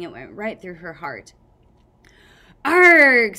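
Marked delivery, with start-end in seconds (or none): 2.6-3.4 loud, higher hammed-up death howl; mock dying howl. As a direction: howl as if stabbed. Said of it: "Sunny howled".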